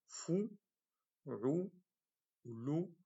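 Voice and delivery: fou, rou, lou with rounded u; damma syllables. Shown as a demonstration. Teacher sounding damma syllables for class. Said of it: "ici la damma".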